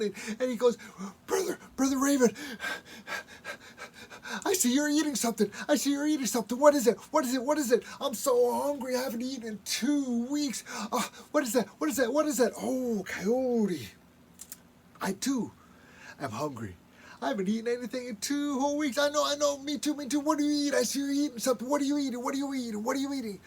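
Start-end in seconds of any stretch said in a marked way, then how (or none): 1.3-12.5 high, loud and fast, pleading; high, fast, loud hungry coyote begging; frantic hungry begging. Acted. Coyote begs Raven for food. 17.2-23.4 high, loud and fast, pleading; high, fast, loud hungry coyote begging; frantic hungry begging. Acted.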